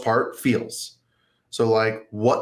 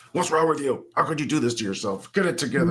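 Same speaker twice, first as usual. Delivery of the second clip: higher, strained scolding without volume; mock self-attack; 4 semitones higher than usual. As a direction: berate yourself in an acted, exasperated voice. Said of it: "start attacking yourself".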